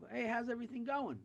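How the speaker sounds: high-pitched, upbeat hello to a buddy; cheery greeting. Acted. Buddy's greeting in told joke.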